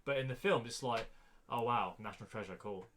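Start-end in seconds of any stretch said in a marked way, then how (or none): none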